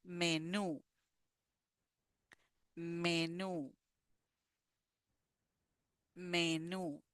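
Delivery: menú with stress on the last syllable, me-NÚ; teacher modelling menú; model word. Shown as a demonstration. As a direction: lean on the final ú of menú. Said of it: "the stress ... in the last syllable".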